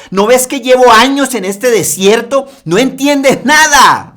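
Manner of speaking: loud, high mocking complaint; sarcastic exasperation. Disciples mocking Jesus in the desert, shouted sarcasm. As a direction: throw it back at him with sarcasm. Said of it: "como diciéndole".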